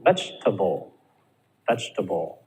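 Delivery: "Vegetable" with a weak tə-bəl ending; schwa in vegetable. Shown as a demonstration. Teacher models "vegetable" with schwa ending. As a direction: reduce table to təbəl. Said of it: "it then becomes schwa".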